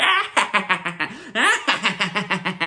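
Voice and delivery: imitated high psychotic cackle; maniacal cackle. Agnes breaking into laughter before Vision, mock maniacal cackle. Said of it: "starts cackling like a maniac".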